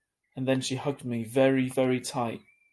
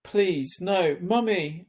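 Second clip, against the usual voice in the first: pleading cry; 7 semitones higher, 4 dB louder, much slower than usual. A narrator's reading. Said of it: "I cried".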